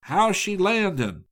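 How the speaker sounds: a captain hailing his steersman over the wind; captain's shout. A narrator's reading. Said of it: "The captain shouted to the steersman".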